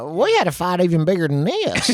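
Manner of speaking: put-on Southern storyteller; tour guide voice. Tour guide smoothing out a bar fight, put-on Southern voice. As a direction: play the guide spinning a tall tale. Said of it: "going in that fucking voice".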